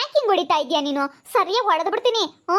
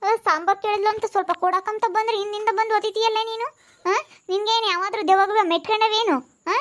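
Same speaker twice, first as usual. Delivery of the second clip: ordinary delivery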